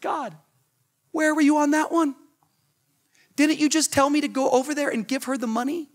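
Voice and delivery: high, whiny questioning; bewildered, pleading grievance; aggrieved complaint. Retold or imitated. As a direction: protest to God in disbelief. Complaining to God after refusal.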